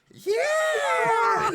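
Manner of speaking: very high, loud drawn-out cheer; crowd cheer. Acted. Normally reserved students answer Chet, wild cheer. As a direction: scream it like an excited student crowd. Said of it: "are just like".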